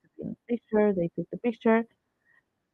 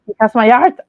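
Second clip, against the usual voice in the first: high, loud fangirl squeal of words; retold excited cry, suddenly loud; excited outburst; 3 semitones higher, 10 dB louder than usual. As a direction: burst out thrilled, reliving the discovery. Reliving seeing own art.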